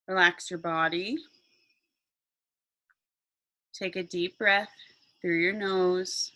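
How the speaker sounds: slow meditation voice with long pauses; guided meditation. Acted. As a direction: guide them calmly into relaxation.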